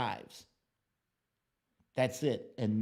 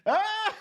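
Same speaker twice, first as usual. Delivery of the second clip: startled yelp; 6 dB louder than usual. Real, not acted. Shocked at a chat comment.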